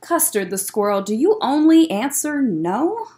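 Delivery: plain reading voice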